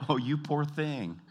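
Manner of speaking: high, slow, drawn-out condescending pity; mock pity. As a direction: act sorry for someone simple-minded. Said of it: "like, with pity".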